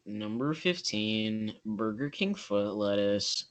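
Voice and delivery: slow, soft, deadpan countdown narration; Chills narrator voice. Retold or imitated. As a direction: read it low and slow like a creepy list video. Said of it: "he can do a really good Chills impression".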